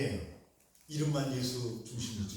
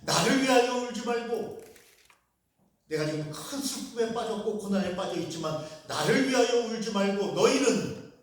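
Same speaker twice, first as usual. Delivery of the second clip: louder, higher fervent sermon; impassioned preaching; 5 semitones higher, 6 dB louder than usual. Acted. Pastor urges the congregation, fervent preaching. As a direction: preach it with rising passion.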